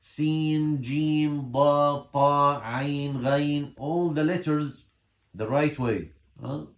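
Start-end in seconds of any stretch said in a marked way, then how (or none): 0.0-3.7 Sin to Ghain pronounced fully, louder; careful Arabic letter names. Shown as a demonstration. Teacher listing how Arabs pronounce letters, careful Arabic articulation of each. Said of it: "they know how to pronounce".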